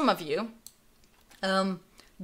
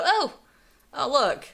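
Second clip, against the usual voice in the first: mock affront; 5 semitones higher, slower than usual. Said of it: "take it in the worst possible way".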